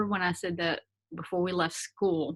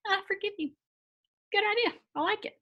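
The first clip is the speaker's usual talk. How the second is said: very high, airy, smug prince voice; bratty prince. Shown as a demonstration. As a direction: play the spoiled prince pleased with himself. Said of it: "Prince Brat's like".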